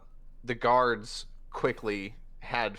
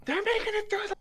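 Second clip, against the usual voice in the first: high panicked cry; panicked guard; faster than usual. Acted. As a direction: yell the warning.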